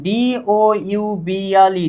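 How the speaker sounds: spelling out. Shown as a demonstration. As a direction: spell it slowly, one letter at a time. Teacher spells out a term.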